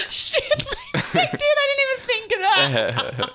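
high, squeaky laughing speech; helplessly amused, pitch way up; real laughter while talking. Real, not acted. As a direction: barely get it out for laughing. Cracked up by co-host's suggested note.

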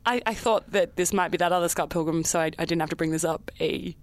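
put-on low, sheepish Canadian accent. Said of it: "You're very Canadian all of a sudden".

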